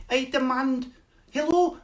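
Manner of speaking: high, mock Scottish leader's voice; Nicola Sturgeon. Scottish leader trying to be seen, high mock accent. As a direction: squeak out a Scottish politician's demand. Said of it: "Your leader was the first".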